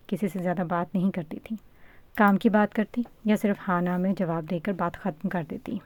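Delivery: plain reading voice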